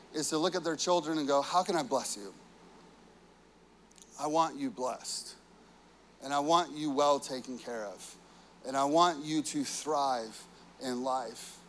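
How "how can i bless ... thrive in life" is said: tender father. Acted. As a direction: speak it gently to your child. Father voicing tender blessing.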